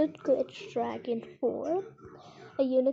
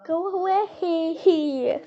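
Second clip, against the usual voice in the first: fed up; 4 semitones higher, 3 dB louder, much slower than usual. Real, not acted. Sister still there.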